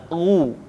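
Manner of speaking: "ru" with lips fully pursed; u parfait. Shown as a demonstration. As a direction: round the lips for a full u in "ru". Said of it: "keluar vokal u dengan sempurna".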